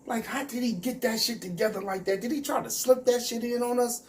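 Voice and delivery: high, incredulous what the fuck; squeaky disbelief at a wild remark. Retold or imitated. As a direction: act stunned by what he said. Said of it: "I'm like".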